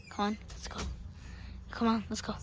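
low, very soft urging; hushed urging. Pretend. Urging a partner onward, hushed and low. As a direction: coax them along under cover. Creeping in.

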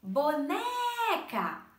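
"boneca" called loudly as bo-NEEE-ca; high, drawn-out NE in "boneca", as if calling across the street; calling out, long NE. Shown as a demonstration. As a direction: shout "boneca" slowly, stretching the middle syllable. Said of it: "Se eu precisar chamar a boneca".

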